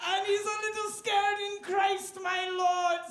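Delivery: high, loud, grandiose declaiming; hammed-up Shakespearean actor. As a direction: proclaim it like a ham on stage. Mock Shakespeare speech, partly sung.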